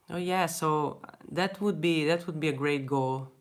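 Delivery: ordinary talk, nothing marked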